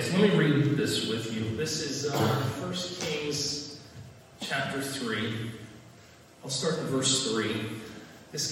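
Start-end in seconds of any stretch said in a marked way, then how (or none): none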